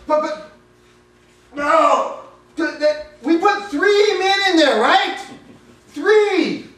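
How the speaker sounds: high, loud stammering alarm; king staring into the furnace, loud and frantic; panicked disbelief. Acted. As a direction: sputter in shocked panic. King sees a fourth man.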